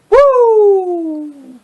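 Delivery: high shouted woo; a big Flair woo; whooping like Ric Flair. Retold or imitated. Ric Flair catchphrase again.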